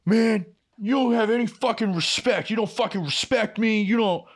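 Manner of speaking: high, mocking whiny coworker voice. Coworker complaining he gets no respect, high and mocking. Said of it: "and he's like".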